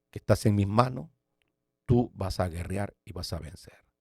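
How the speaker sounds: soft, low, reassuring voice; voz suave. Retold or imitated. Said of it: "una voz suave me dijo". (voz suave, baja y tranquilizadora)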